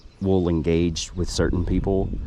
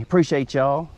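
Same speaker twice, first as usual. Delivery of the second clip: a light mock Southern drawl, pitched higher; playful Southern accent, a bit higher; Hannah Barron's drawl; 7 semitones higher than usual. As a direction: quote her catchphrase in her Southern accent. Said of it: "As Hannah Barron would say".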